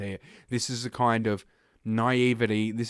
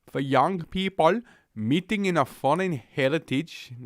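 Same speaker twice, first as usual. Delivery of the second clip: mock Finnish accent; 6 semitones higher than usual. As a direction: parody a Finnish police commissioner in a Finnish accent. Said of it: "I have no idea what the Finnish accent is like".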